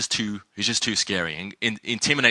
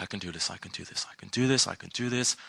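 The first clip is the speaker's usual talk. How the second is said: nervous self-pep talk; 3 semitones lower, 8 dB quieter than usual. Retold or imitated. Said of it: "and I was like".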